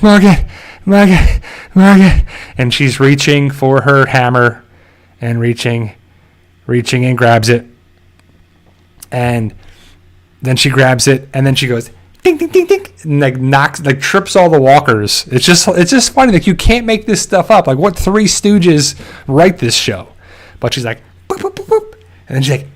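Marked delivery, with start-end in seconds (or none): 0.0-2.2 high, louder, urgent calling of a name; mock calling out. Madison calling for Morgan while pinned in sand, hammed-up shout. 12.2-12.9 very high sing-song ding sounds; mock hammer dings. Madison's hammer knocking walkers, high cartoon dings. 21.3-21.9 very high, soft cartoon boops; mock boop sounds. Madison bopping walkers, high silly boops. Imitating hammer hits.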